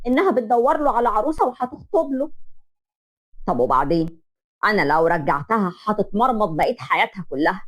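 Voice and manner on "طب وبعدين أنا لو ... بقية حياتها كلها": low, heavy father's voice; worried father. Acted. Father worrying to his wife, low and heavy. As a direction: weigh every word with worry.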